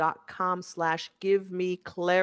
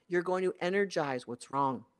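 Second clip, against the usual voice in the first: ordinary delivery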